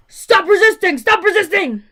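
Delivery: loud, high barked order; shouted police command; yelling cop. Retold or imitated. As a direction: yell it right in her ear like a cop. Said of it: "he's yelling in my ear".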